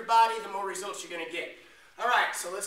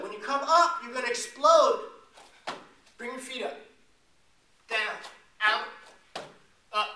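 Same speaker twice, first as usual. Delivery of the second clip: louder, punchy cue building to explode; energetic coach cue; 2 semitones higher, 4 dB louder than usual. Real, not acted. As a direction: hype them up to explode off the floor. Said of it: "coach cueing the explosive move".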